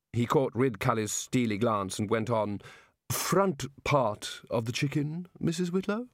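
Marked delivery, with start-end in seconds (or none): 3.1-6.1 put-on slow, pompous old wizard; fuddled don after a belch; Chair of Indefinite Studies voice. The Chair speaks to Missus Whitlow, slow and mock-genteel.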